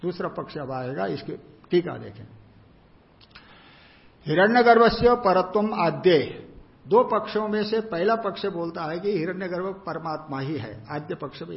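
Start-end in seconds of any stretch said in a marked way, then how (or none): 4.3-6.5 loud, higher Sanskrit reading; projected, intoned quotation; reading out scripture. Real, not acted. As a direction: announce the text line in a raised voice. Reads out the commentary text.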